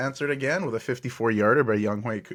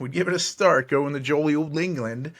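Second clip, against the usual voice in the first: hammed-up mock English accent, pitched higher; bad English accent; 5 semitones higher, 5 dB louder than usual. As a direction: put on a clumsy jolly-old-England accent. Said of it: "that was a bad accent".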